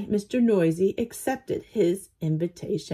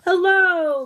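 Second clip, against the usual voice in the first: loud high booming hello; 9 semitones higher, 6 dB louder than usual. Acted. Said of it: "boomed the giant in his quietest voice".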